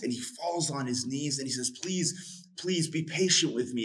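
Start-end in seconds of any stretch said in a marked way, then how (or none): 1.8-3.8 high-pitched begging servant, retold; pleading servant. Servant begging the king for patience, high pleading. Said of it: "it says he pleads with him".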